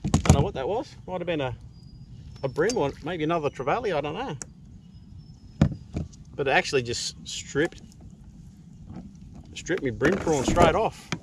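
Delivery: real surprise at losing the bait; surprised. Real, not acted. Fish just stripped his bait.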